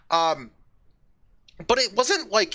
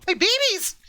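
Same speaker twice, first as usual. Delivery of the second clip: high, sudden mock-excited cry; mock excited call. Playing gators finding their nest, mock excited call. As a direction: call out in high, playful alarm.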